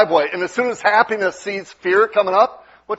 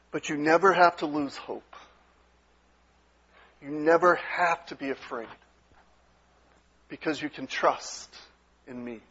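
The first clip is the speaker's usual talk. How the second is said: softer, gentle reassurance trailing to near silence; tender, hushed voice of God; 3 semitones lower, 8 dB quieter than usual. Retold or imitated. God reassuring frightened people, tender and hushed. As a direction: lean in close and calm their fear. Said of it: "in this kind of tone".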